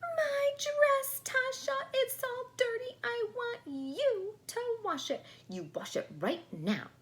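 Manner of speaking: very high, loud shrill tantrum; doll screaming. Acted. The doll screams at Natasha over her dirty dress. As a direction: scream it like a spoiled toy in a fit. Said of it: "started to scream".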